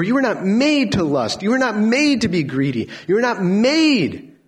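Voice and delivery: loud, high pleading shout, slow and heavy; God's impassioned cry. Acted. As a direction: cry out as a heartbroken God begging his people. God pleading loudly with sinners.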